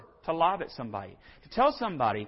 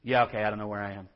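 low, quick concession; reluctant admission; 7 semitones lower, much faster than usual. Acted. Preacher voices lost driver finally giving in, reluctantly. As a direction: rush out a grudging admission, pitched low. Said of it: "and finally you say".

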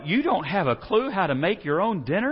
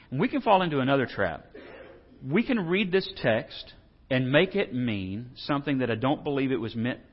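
normal talking voice

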